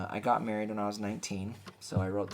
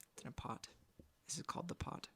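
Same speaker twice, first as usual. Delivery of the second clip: whispering; 7 semitones higher, 11 dB quieter, faster than usual. Pretend. Whispered aside about the pot.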